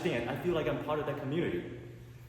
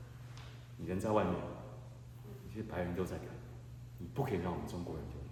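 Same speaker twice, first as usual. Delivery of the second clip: dad's solemn words; 8 semitones lower, 9 dB quieter than usual. Retold or imitated. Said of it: "I go".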